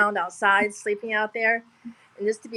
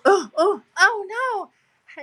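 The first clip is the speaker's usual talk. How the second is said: retold alarmed cry; 11 semitones higher, 4 dB louder than usual. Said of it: "and she goes".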